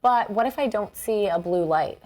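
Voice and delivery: ordinary talk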